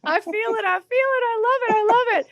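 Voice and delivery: very high-pitched delighted exclaiming; thrilled. Real, not acted. Host reacts to singer's high note, high-pitched elation. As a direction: gush in a high, elated voice.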